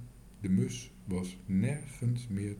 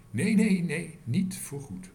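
insistent refusal; 6 semitones higher, 6 dB louder than usual. Acted. Tos refuses, aunts want forever.

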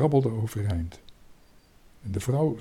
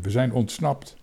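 plain reading voice

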